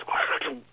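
crackly, unvoiced radio noise; imitated radio static. Teller showing how the radio cut off, imitated crackle. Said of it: "the radio goes".

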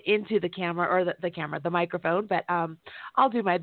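normal talking voice

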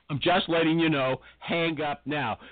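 ordinary talk

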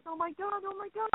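high, breathless oh my God, pitched well up; wife stunned at the door, astonished cry; astonished gasp. Retold or imitated. Wife at the door amazed by the gift, high breathless exclamation.

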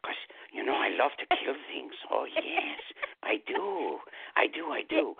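high, gleeful whispery roach villain; a sneaky cockroach relishing the kill. Acted. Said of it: "I once did that voice for a Roach Motel commercial".

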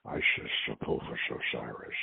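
low hushed whisper of names. A narrator's reading. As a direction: breathe the names in a trance. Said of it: "as she whispered".

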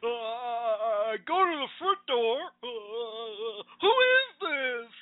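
a hammed-up scared caller asking who is this; imitating scared man. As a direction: stammer it like the spooked old man on the call. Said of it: "You can tell how scared that guy was".

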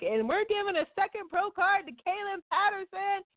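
high, bright announcer call. Retold or imitated. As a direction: announce it like an emcee. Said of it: "they were like".

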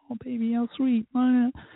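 high gushing aww; swooning coo. Retold or imitated. Thinking of her sweet message, high swooning coo. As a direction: gush over the sweetness.

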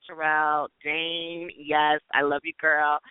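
ordinary talk, nothing marked

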